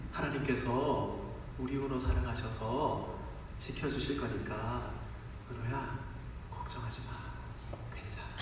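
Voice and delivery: soft, hushed and tender; comforting father. Retold or imitated. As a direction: soothe a frightened child to sleep. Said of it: "위로하면서 이렇게 말했습니다". (아이를 달래듯 부드럽고 조용하게)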